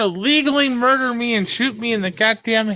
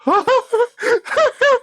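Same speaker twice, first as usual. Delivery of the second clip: relieved laugh; 11 semitones higher than usual. Real, not acted. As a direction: burst of high relieved laughter. Relieved after finally beating stage.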